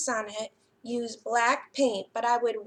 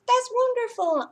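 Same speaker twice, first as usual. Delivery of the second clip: enthusiastic praise; 11 semitones higher, 6 dB louder than usual. Real, not acted. Praising children watching at home.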